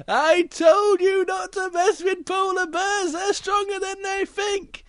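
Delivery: high, loud, slow scolding; Big Bear voice. Acted. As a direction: holler a told-you-so up to the cub. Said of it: "Big Bear says".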